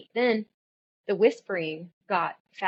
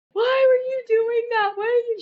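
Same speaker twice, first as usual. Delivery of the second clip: retold frantic cry; 6 dB louder than usual. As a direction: cry out loudly in high-pitched panic. Retold screaming at daughter on phone.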